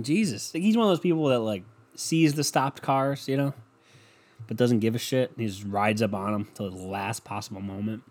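ordinary talk

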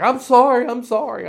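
put-on a mortified young dad blurting a quick apology, high and loud; hasty embarrassed father saying sorry twice; flustered apology. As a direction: apologize in a rush, then settle.

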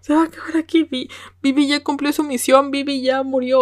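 high, anguished "ay no no no"; dismayed cry. Retold or imitated. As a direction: relive the shock of losing her. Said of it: "Y yo dije". (fan lamenting the heroine's fate, high and anguished)

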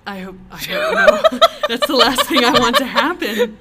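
real laughter at the thought of her listening; laughing dread. Real, not acted. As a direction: squeal it out between laughs.

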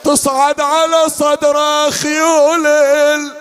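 high mournful wail; grieving cry. Acted. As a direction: cry out as Zaynab in grief.

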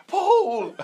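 high, loud call across the street; excited shout. Retold or imitated. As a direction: yell it like a delighted fan, half laughing. Mates excitedly calling out to star.

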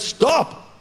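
sharp high command to halt; commanding shout. Retold or imitated. Jesus commanding wind and waves, high sharp shout. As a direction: bark it to stop the storm. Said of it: "he stands up and goes".

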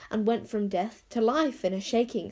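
usual speaking voice

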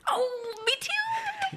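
high-pitched squeal of delight; excited. Real, not acted. Host learns co-host shares her team, excited squeal. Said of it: "I was so excited".

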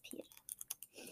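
dreamy unvoiced whisper. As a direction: breathe the name in a whisper. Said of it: "You're kinda scaring me".

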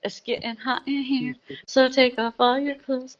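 loose, stoned singsong. Real, not acted. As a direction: let it float out high and stoned. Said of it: "Sorry, I'm fucking stupid stoned".

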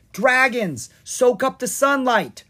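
Bright Eye calls to the dragons; called out. Acted. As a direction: call the dragons to action. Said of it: "Bright Eye called out".

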